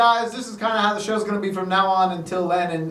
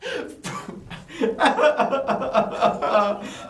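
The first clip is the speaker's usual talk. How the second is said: high, stuttering mock sobs; hammed-up fake sobbing; fake crying; 6 semitones higher than usual. After farewell song to co-host, fake crying. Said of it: "Just kidding, I'm not crying".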